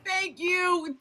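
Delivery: usual speaking voice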